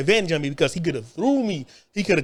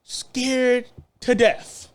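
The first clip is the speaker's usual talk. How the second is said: very slow, higher, drawn out; dragged out; 7 semitones higher, much slower than usual. Real, not acted. Telling listeners how scared he was, very slow and drawn out. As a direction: stretch every word, much higher. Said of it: "I was scared to death".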